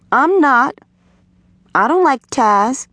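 put-on high-pitched girlish denial; defensive little girl. A narrator's reading. Girl denies liking the boy.

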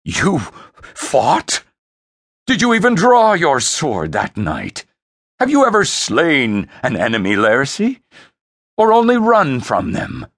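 loud, high incredulous scorn; forceful, jeering interrogation; disgust. Acted. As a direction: challenge his courage with contempt. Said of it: "voice twisted in disgust".

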